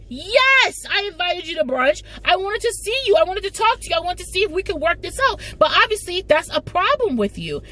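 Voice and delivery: high-pitched retold hurt plea; Issa pleading. Issa pleading with Molly at brunch, high and hurt.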